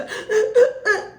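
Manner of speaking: high, gasping mock sobs; exaggerated imitation of crying; hammed-up crocodile-tear gasps; fake sobbing. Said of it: "The fakest thing ever is when Candice".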